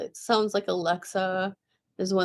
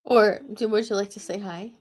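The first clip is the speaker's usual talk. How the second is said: usual speaking voice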